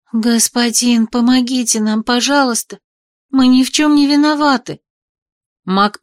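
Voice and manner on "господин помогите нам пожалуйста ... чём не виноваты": put-on high, frightened begging girl; desperate pleading. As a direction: plead for help in fear. Captive girl begs the lord. (отчаянная мольба)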